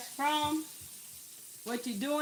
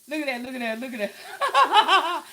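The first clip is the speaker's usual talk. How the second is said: laughing delight; 9 dB louder than usual. Real, not acted. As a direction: laugh as you show it off. Showing off the soaked chicken.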